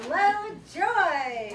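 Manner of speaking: slow, high, laughing greeting; laughing welcome. Shown as a demonstration. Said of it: "raise your right hand and say".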